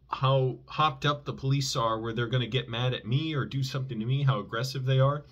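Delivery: ordinary talk, nothing marked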